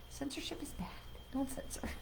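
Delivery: breathy giggling whisper; laughing whisper. Acted.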